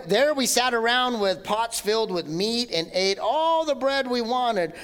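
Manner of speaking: normal talking voice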